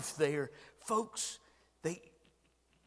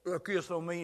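normal talking voice

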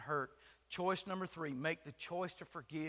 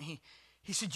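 normal talking voice

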